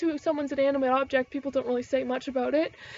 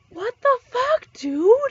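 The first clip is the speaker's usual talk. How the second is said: high, slow, loud what-the-fuck; drawn-out disbelief; 9 semitones higher, 7 dB louder, slower than usual. Real, not acted. Ranting to viewers about pet threats, slow and incredulous. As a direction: stretch it out in incredulity.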